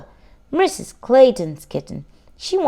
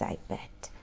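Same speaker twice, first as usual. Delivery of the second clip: ordinary delivery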